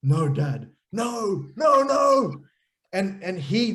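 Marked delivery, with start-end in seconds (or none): 0.0-2.4 put-on high, protesting kid, building to loud cries; dismayed child pleading with Dad, climbing higher and louder; upset nine-year-old son. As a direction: mimic a boy's escalating high-pitched refusals. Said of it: "here's my nine-year-old Malachi going".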